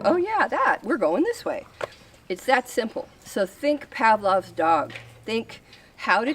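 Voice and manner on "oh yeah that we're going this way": happy puppy. Pretend. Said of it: "they go".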